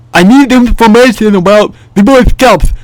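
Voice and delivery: loud, high mock Russian accent; thick Russian accent. Stranger asking about the Boy Scouts, loud thick Russian accent. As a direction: blurt it in a barely intelligible Russian accent. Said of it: "really thick Russian accent".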